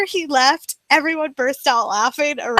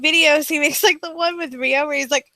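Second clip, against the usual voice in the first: ordinary delivery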